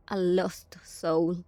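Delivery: ordinary talk, nothing marked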